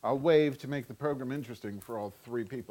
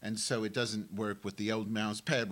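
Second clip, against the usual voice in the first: ordinary talk, nothing marked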